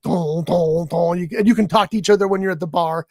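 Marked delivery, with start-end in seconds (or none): 0.0-1.2 low, drawn-out thumping "dong"; mimicked club thud; booming club beat. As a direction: imitate a pounding nightclub bass. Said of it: "No, it's not like".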